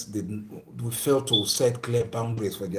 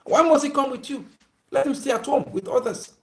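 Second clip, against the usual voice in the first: indignant objection; 12 semitones higher, 4 dB louder than usual. Retold or imitated. Voicing other parent objecting.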